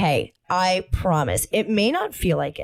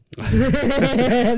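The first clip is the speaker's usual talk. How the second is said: stoned giggle; 5 semitones higher than usual. Acted. Giggling during stoned role-play.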